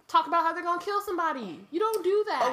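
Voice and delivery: high, fast incredulous outburst; exasperated. Real, not acted. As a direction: pitch jumps up in disbelief. Exasperated warning about creepy voices.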